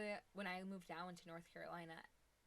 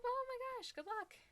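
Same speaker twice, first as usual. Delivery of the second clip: very high, loud excited burst; excited exclamation; 7 dB louder than usual. Real, not acted. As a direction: cheer them on at a squeal-high pitch. High exclaimed send-off to viewer.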